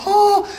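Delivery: sharp high startled oh; a quick high gasp of alarm; frightened gasp. Retold or imitated. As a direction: gasp as the water rushes up. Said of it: "I'm looking out going".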